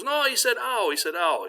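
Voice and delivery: high, excited correction; hammed-up protest imitating the dog's cry; insistent dog owner. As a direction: insist on it, pitch way up.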